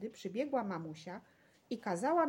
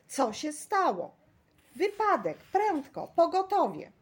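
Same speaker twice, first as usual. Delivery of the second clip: high, loud cries of alarm; panicked bystanders raising the alarm; alarmed shouts; 8 semitones higher, 8 dB louder than usual. A narrator's reading. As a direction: call out urgently for help. Crowd shouts after an accident.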